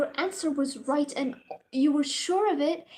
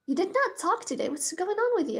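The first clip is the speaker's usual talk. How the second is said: higher-pitched, quick imitation of a teacher; confronting professor; 6 semitones higher, faster than usual. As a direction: put the student on the spot, mimicking. Imitating professor confronting silent student.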